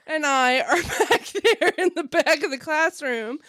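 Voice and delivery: usual speaking voice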